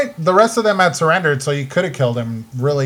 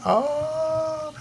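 high squeak; 12 semitones higher, 8 dB quieter than usual. Pretend.

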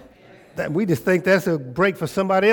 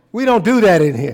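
second take punched up louder; driving the line home louder; louder repeat; 4 semitones higher, 7 dB louder than usual. Shown as a demonstration.

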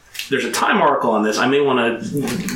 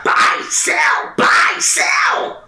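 frantic shout; 3 dB louder, much slower than usual. Retold or imitated. Quoting a frantic trading gremlin.

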